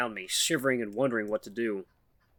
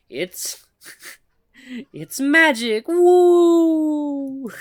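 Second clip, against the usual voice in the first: mock mystical magic; 14 semitones higher, 9 dB louder, much slower than usual.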